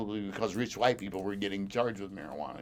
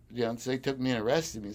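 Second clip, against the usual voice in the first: ordinary talk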